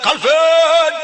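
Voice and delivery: very loud, high cry; hammed-up grieving shout; crying scream. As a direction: wail the name with all your force. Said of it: "dia berteriak".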